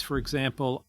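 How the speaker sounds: ordinary talk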